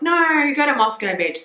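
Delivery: mock telling-off. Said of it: "It's like".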